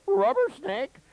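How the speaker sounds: loud, very high yelp; comic high-pitched panic; mock panicked cry. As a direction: imitate a terrified patient calling it out. Retold panicked patient identifying snakes.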